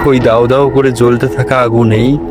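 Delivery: ordinary delivery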